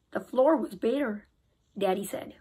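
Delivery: plain reading voice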